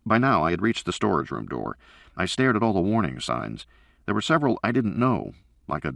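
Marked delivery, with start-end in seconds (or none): none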